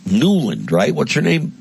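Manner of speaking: usual speaking voice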